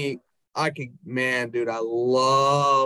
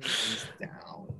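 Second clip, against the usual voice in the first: murmured; 5 semitones lower, 11 dB quieter than usual. Real, not acted. Quiet boast.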